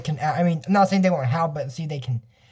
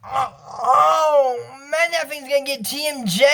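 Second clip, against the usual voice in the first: mock alarm; 4 semitones higher than usual. Alarmed reaction to the monster.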